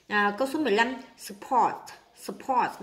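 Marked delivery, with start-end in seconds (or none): 1.2-2.8 "support" stressed on its second syllable. Shown as a demonstration. Teacher showing word stress to students, second-syllable stress on "support". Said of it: "nhấn âm hai".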